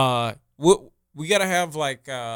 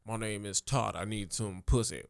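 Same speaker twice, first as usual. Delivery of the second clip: Todd voice; 4 semitones lower, 11 dB quieter than usual. Acted. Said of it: "It's me, Todd".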